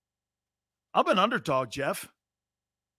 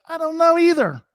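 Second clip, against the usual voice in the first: wife's voice; 11 semitones higher, 8 dB louder than usual. Retold or imitated. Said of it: "She's like".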